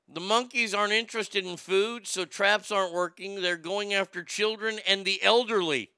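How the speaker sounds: usual speaking voice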